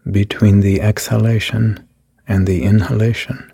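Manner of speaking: calm, even meditation voice with long pauses; guided meditation. Acted. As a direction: lead the listener gently through each breath. Leads a guided body meditation.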